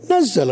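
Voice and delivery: "نزل" as nazzala, leaning on the double z; doubled z. Shown as a demonstration. Teacher proves the point to students, "نزل" with doubled z. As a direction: press the shadda in "نزل". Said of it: "ما تجي بالتشديد؟".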